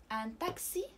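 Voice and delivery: ان تاكسي with a crisp ks; French ان تاكسي, x as unvoiced ks; ks sound. Shown as a demonstration. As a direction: say تاكسي as "taksi", the x as ks. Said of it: "كنسمعوا كس".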